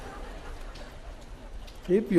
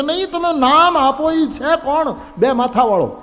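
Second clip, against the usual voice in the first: demanding disciple; 7 semitones higher, 6 dB louder than usual. Acted.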